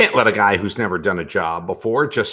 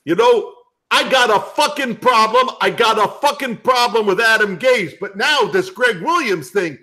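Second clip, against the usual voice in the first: New York guy; 11 semitones higher than usual. Acted. New York guy complaining about tackling, worked-up New York accent. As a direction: rant like an aggrieved New York sports fan. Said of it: "He talks like this. He goes".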